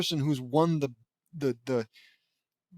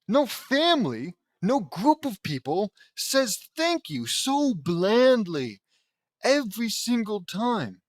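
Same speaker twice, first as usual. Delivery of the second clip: loud, high, slow emphatic outburst; exasperated rant; 6 semitones higher, 5 dB louder, slower than usual. Real, not acted. Reader ranting at bland dialogue, exasperated. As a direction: hammer each phrase in disbelief.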